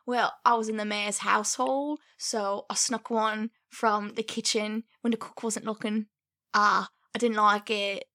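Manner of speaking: put-on British villager accent, measured; Renaissance-fair peasant voice; poor village person. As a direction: confess it as a humble pretend villager. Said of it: "like, a poor village person".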